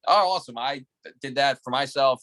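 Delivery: ordinary delivery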